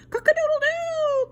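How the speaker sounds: very high, loud shrieking crow; panicked rooster crowing; frightened crow. Acted. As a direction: crow in terror like a scared rooster. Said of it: "cried the rooster ... very much frightened".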